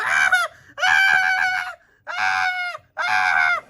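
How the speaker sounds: very high, loud shrieks; terrified screaming. Acted. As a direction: scream over and over on the ride. Screaming on the ride.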